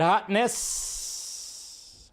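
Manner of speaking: playful, lingering hiss on the end; drawn-out admiration. Acted. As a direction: stretch "hotness" into a long trailing hiss. Admiring a photo, drawn-out word.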